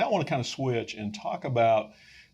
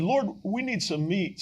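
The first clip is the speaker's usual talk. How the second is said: pleading prayer; 9 semitones higher than usual. Retold or imitated. Retold prayer, raised pitch.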